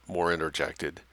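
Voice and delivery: usual speaking voice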